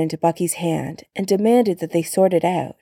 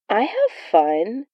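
protesting; 3 semitones higher than usual. Acted. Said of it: "Steve protested".

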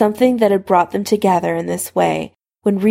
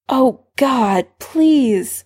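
lightly moaned, pitched-up begging; moaning plea; 5 semitones higher, much slower than usual. A narrator's reading. Severus pleads with Harry, lightly moaning. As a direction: plead in a higher, understated moan. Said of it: "Severus moaned".

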